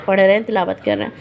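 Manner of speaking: ordinary delivery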